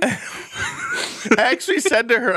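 high pitched laughing. Real, not acted. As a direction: laugh through the words. Laughing while telling.

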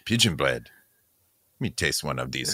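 low, quiet French judge voice; French accent. Acted. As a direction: murmur like a snooty French taster. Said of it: "does it sound like I have a French accent".

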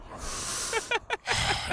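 rasping respirator breath; wheezy voice. Retold or imitated. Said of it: "We got Darth Vader breathing".

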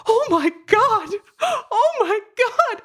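high, drawn-out sobbing wail, hammed up; mock crying, slow and high-pitched; imitated tearful screaming. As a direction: re-enact being overcome with awe. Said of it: "I was crying and sobbing and screaming".

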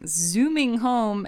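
ordinary delivery

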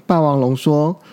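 ordinary delivery, nothing marked

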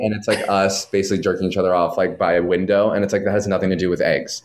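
ordinary delivery

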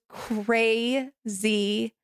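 slow, stretched-out verdict; drawn-out emphasis. Real, not acted. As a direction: stretch CRAZY into two long syllables.

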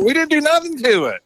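very high-pitched feigned innocence; mock innocence. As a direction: protest innocence in a squeaky-high retelling. Retold denial to angry prank victim.

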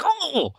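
high startled Oh; a quick surprised gasp of an old man; old man's mock yelp. Old man hit by the door, mock yelp. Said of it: "he was like".

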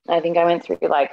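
usual speaking voice

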